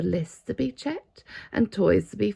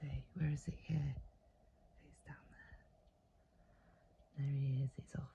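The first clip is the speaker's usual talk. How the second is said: quiet aside; 6 semitones lower, 14 dB quieter, faster than usual. Real, not acted. Parent quietly helps the child spot the penguin. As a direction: point it out softly.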